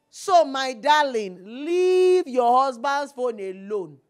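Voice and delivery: drawn out, loud and firm; loud, slow scolding to the ladies; slow loud warning. Real, not acted. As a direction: lay it down slowly like a final warning. Warning women, slow loud emphasis.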